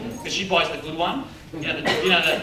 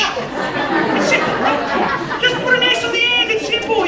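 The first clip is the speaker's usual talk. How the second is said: much higher, louder, drawn-out; excited; 5 dB louder, slower than usual. Acted. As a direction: gush it like it's obvious. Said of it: "mock explanation".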